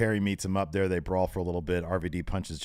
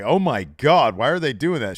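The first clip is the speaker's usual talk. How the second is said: high, loud mock exclamation; higher, louder retold outcry; imitating her dismay; 5 semitones higher, 8 dB louder than usual. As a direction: mimic a dismayed viewer, pitch raised. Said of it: "she was like".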